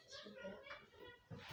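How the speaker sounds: petite voix suppliante. Pretend. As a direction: beg in a tiny drawn-out voice. Repeated plea, put-on tiny voice. (petite voix aiguë et lente qui supplie)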